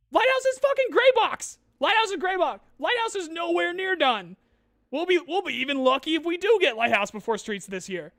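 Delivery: high, loud shout easing into insistent ranting; exasperated ranting. Real, not acted. Streamer ranting that Lighthouse is unfinished, exasperated shouting. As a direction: burst out yelling, then gradually come down.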